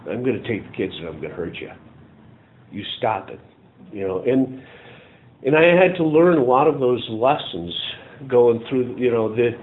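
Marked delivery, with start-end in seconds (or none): 0.1-3.4 quiet threat. Retold or imitated. Wife's retold threat.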